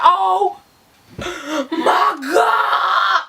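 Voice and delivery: high, drawn-out shocked cry; horrified wail. Retold or imitated. Storyteller hears Z shot a man, wailing in horror.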